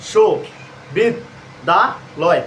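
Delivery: শো, বিদ, দা, লয় each said as a separate beat with pauses; clipped syllable-by-syllable count; broken into syllables. Shown as a demonstration. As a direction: chop the word into syllables and count them. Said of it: "এইভাবে ভেঙে ভেঙে উচ্চারণ করলে".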